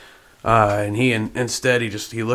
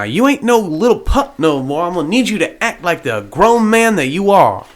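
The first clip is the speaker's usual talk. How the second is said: higher, louder, emphatic character voice; raised voice, punching the words; stern master to his pup; 7 semitones higher, 7 dB louder than usual. Acted. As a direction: firmly tell the pup to act grown.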